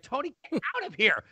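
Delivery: high mock-mom voice; put-on high-pitched brush-off; mom shooing dad. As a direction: shoo him off in an imitated wife's voice. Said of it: "She's, like, shooing him away".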